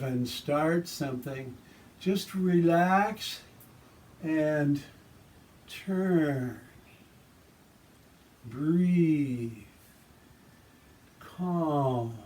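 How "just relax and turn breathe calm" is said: serene tai chi cues. Shown as a demonstration.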